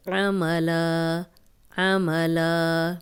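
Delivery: عملا slowly, clear ع, final alif held; slow recited demo. Shown as a demonstration. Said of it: "نون کی پیش کو لمبا ہونے سے ہم بچائیں گے". (عملا آہستہ، عین کی آواز کے ساتھ)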